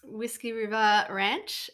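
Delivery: usual speaking voice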